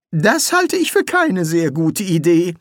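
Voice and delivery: weary wizard, held back; Rincewind. A narrator's reading. Rincewind objects. (höhere, langsame Zaubererstimme)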